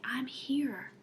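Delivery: soft whispered reassurance; whispered comfort. Retold or imitated. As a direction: breathe it like holding a crying child. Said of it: "and I just say".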